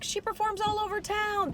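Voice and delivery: high-pitched emcee with a weaker credit; mock introduction, pitched well up; stage introduction. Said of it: "The audience listens in a different way".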